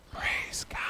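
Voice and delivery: voiceless stage whisper; loud whisper. Shown as a demonstration. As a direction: whisper it loudly. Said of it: "loudly whisper".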